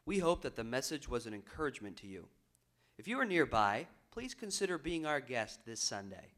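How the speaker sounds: low, measured announcer read; formal recorded outro; broadcast announcer sign-off. Real, not acted. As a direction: invite listeners courteously, evenly.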